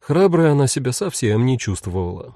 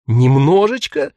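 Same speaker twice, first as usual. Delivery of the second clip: incredulous; 3 semitones higher, 5 dB louder, slower than usual. Acted. Both ask in surprise.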